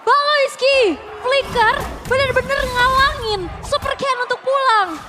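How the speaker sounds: high, loud hyped call; esports caster. Real, not acted. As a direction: yell the fight play by play. Hyped play-by-play of teamfight.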